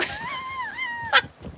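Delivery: high-pitched delighted squeal of laughter; shrill delighted laugh; high shrieking laugh. Real, not acted. Laughing at the dog.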